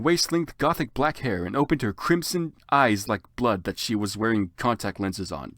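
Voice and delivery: normal talking voice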